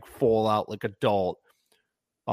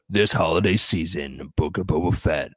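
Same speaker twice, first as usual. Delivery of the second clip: lower, booming trailer announcer voice; movie trailer narrator; 4 semitones lower than usual. Retold or imitated. Co-host pitching a Boba Fett promo, trailer announcer voice. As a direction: sell it like a dramatic movie trailer. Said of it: "that sounded a lot like Robert Rodriguez".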